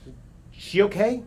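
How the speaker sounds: high, worried imitated ask; anxious question. The horrified friend asking if she is okay, high and anxious. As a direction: voice the scared girl's question.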